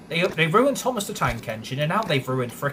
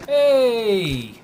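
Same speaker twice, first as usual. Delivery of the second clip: sudden shout; 10 semitones higher, 8 dB louder than usual. Real, not acted. Shouts at the game.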